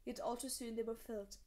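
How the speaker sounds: ordinary delivery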